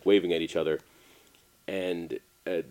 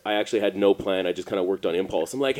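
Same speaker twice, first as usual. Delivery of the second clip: ordinary delivery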